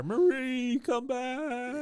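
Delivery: high, drawn-out mock melodramatic cry; Streetcar-style wail. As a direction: call her back like a tragic lover. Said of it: "streetcar named Desire".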